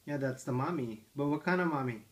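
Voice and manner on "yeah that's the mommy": ordinary talk, nothing marked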